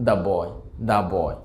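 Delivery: put-on "the boy" spoken "da boy", the with a short a sound; teacher models "the boy" as "da boy". Shown as a demonstration. Teacher demonstrates da before a consonant. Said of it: "এটির উচ্চারণটা হবে দা".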